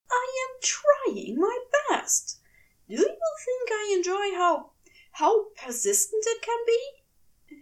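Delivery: prim character voice lifted high, exasperated; Aziraphale flustered. Acted. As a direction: plead high and wounded, defending himself.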